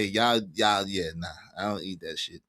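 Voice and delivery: ordinary delivery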